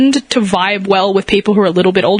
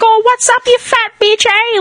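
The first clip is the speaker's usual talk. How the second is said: high-pitched broad Aussie bogan; hammed-up Australian bogan, pitched way up; horrible bogan accent; 12 semitones higher than usual. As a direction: greet mum in a crass, exaggerated bogan accent. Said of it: "in a really horrible accent".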